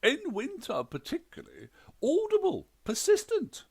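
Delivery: very high, loud complaint; indignant ghost wife. A narrator's reading. Ghost wife complains of husband's breathing, very high and loud. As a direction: stab out each word, high and loud.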